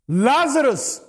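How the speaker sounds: loud, high commanding call; crying out. Retold or imitated. Jesus calling Lazarus out of the tomb, crying out. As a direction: call the dead man out, drawn out and forceful. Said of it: "and cries out".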